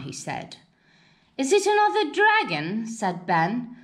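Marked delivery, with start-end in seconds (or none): 1.4-2.9 higher and fast, excited question; a kid hoping for another dragon; breathless high-pitched eagerness; eager boy. Acted. Ben eagerly asks about dragon.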